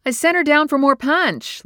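called out high and loud across the room; calling out. A narrator's reading. Linda calling across the party, high and loud. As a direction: raise the voice to carry over a party.

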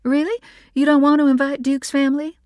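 can't believe what she hears; surprised mother. Acted. Mother reacting to son's refusal, incredulous.

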